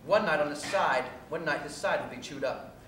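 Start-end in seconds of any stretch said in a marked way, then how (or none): none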